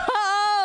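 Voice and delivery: high, loud burst of laughter. Real, not acted. Laughs at audience answer.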